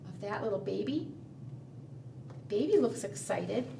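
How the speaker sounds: ordinary delivery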